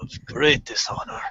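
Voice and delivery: slow, breathy wise-master murmur; a hushed mock sensei scolding; Mister Miyagi. Mister Miyagi scolding the student, slow hushed mock voice. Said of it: "Mister Miyagi was very upset".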